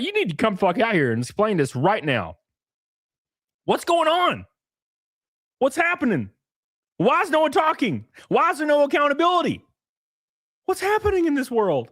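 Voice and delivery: high, pressing demands for answers; demanding confrontation. Pretend. Conservatives confronting Tim Walz at home, high insistent demands. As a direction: corner him at his door and demand answers. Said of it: "be like".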